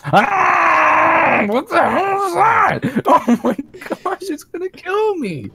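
high, loud, terrified shouting; panicked scream. Real, not acted. Startled by something in game.

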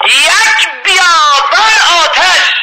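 high, slow, drawn-out cry of horror; awestruck cry. Acted. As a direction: cry out the desert of fire. Preacher repeats vision, heightened.

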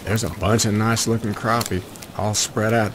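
ordinary talk

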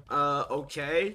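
ordinary talk, nothing marked

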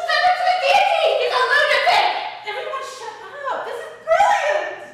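thrilled laughing shouts; gleeful shrieking. Acted. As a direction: shriek with delight. Noble loving the chaos.

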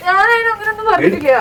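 sobbing. Real, not acted. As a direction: cry out the denial through tears.

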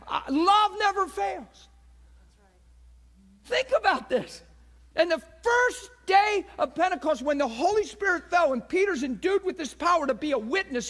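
loud, high-pitched sermon at full force; impassioned preaching. Real, not acted. Preacher proclaiming Pentecost to the congregation, impassioned. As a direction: preach it hard, driving the key words.

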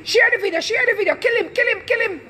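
high mock mob voice egging on; mocking online mob. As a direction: jeer like a mob calling for blood. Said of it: "They were like".

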